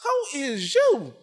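slow, drawn-out, high demand; retold indignant challenge. Repeats challenge to the enemy.

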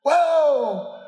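high calling whoa to runaway horses; hollering whoa. Retold or imitated. To the runaway horses, a high hollered whoa. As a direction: yell it high to stop the horses. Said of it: "I was hollering".

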